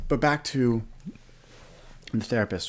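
talking through a burp; words broken by a burp. Real, not acted. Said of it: "burping all over the place".

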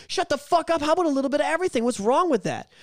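exasperated outburst. Real, not acted.

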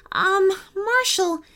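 put-on high, hesitant child's question; tentative child. A narrator's reading. Sean cautiously questions his teddy, tentative. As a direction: ask it carefully, pitch lifted.

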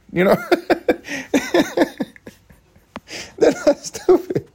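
helpless giggle into high, squeaky words; real laughing. Real, not acted. Podcaster defending Minnesotans' intelligence, laughing.

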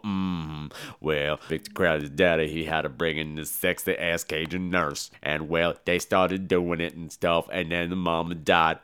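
low, soft storyteller's rumble; deep Tony Todd voice. Retold or imitated. Tony Todd's character tells the backstory, low and soft. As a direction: drop way down and spin the tale low.

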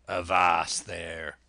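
loud, low, drawn-out pirate order; commanding sea-dog cry; cried command. A narrator's reading. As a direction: call a halt with slow force. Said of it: "cried Silver".

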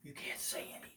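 hushed conspiratorial whisper. Acted. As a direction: whisper it like it's a secret. Mock secret, whispering.

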